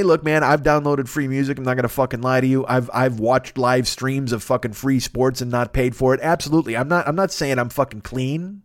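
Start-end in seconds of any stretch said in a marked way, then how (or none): none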